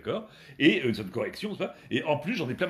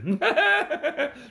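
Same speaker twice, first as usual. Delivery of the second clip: laughing high and bright at himself; a real giggle mid-rant; high burst of laughter; 12 semitones higher, 3 dB louder than usual. Real, not acted. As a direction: break into a short laugh.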